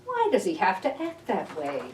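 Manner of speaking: ordinary delivery, nothing marked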